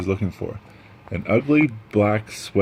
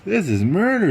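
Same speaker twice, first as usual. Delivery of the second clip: 1 groaning players; 10 semitones higher, 4 dB louder than usual. Acted. Exhausted team complains.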